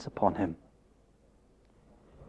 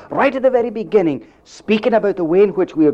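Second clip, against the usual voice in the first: higher and faster, pressing the point; rising fervour; 6 semitones higher, 3 dB louder, faster than usual. Real, not acted. Preacher pressing his congregation, faster and higher. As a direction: drive the phrase home with urgency. Preacher repeats phrase for emphasis.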